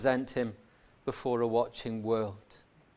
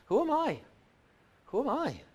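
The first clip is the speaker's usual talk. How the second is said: higher, pleading cry of who am I; Paul wrestling with his unworthiness; a preacher voicing Paul's anguish; 8 semitones higher, 7 dB louder than usual. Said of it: "says Paul".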